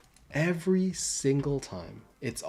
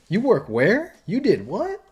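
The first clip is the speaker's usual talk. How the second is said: high, louder startled questions; surprised; 9 semitones higher, 8 dB louder, slower than usual. Retold or imitated. Professional learning who he is, surprised and loud. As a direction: jolt up in disbelief. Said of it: "you see their face light up".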